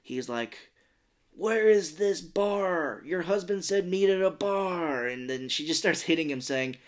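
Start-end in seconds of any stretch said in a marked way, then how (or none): 1.3-5.1 slow, loud, raised-pitch demand; mock demanding. Man interrogating a mother about the bar, mock demanding. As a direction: draw out each question forcefully.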